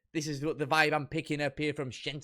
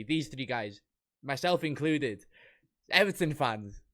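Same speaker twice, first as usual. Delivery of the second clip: ordinary talk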